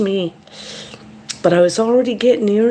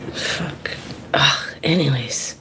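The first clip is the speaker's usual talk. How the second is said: under-the-breath swear, voice dropped to a whisper; whispered aside; 5 semitones lower, 5 dB quieter than usual. Real, not acted. Annoyed at herself, whispering her frustration. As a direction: breathe it out, barely voiced, like cursing to yourself.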